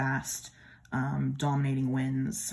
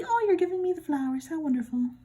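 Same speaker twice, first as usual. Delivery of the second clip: high sweet voice; 14 semitones higher, 4 dB louder than usual. Acted. Voicing happy card figure.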